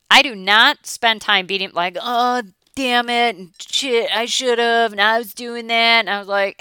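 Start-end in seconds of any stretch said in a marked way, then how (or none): 2.0-6.0 a slow, exasperated scold aimed at herself; mock self-scolding. Coach scolding herself over a slip, mock frustration. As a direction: grumble at your own slip-up. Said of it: "that is literally how I talk to myself".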